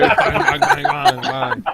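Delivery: laughing bang bang noises; gleeful mock gunfire; mock gunshot bangs. Gunfire sound imitation laughing.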